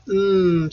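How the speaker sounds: "M" as a sustained mmm hum; letter sound demo. Shown as a demonstration. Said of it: "You hear the M sound".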